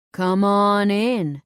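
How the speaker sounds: slow, loud southern twang on "come on in". Acted. Doorman waving her into the bar, loud slow southern twang. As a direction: welcome her in like a good ol' Southern boy. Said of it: "his voice heavily laced with a southern twang".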